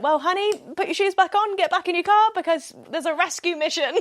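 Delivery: high, loud, mock-bossy honey; mock-dramatic rescue order to a friend; sassy command. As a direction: boss your friend playfully into the car.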